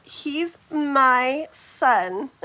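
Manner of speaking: drawn out word by word; very slow, pointed delivery; slow, firm statement. Acted. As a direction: stretch each word to stand your ground. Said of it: "like that".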